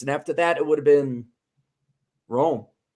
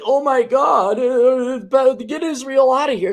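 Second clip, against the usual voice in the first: mock outraged protester, pitched up and louder; mocking whine; 7 semitones higher, 6 dB louder than usual. Mocking people who want Israel out, in a high whiny voice. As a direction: parody the critics with a high, exasperated cry. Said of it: "And now everyone's like".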